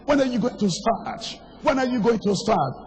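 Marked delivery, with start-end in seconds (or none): none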